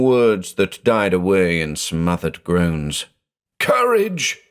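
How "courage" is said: very high, shrill call of encouragement; shriek. Acted. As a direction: shriek it across the dark room. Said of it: "he shrieked".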